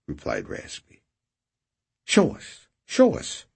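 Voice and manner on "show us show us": high, greedy repeated plea; eager demand. Acted. Raspy urging Redrick to show the swag, eagerly. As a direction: wheedle it out, much higher.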